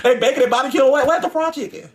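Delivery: complaining on the cruise ship in mock disbelief. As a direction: ask like you can't believe there's no fried chicken. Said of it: "And I'm looking like".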